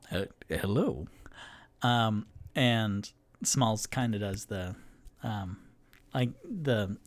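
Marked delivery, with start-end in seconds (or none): 0.1-1.1 shy deep hello. Acted. Half-orc greets child.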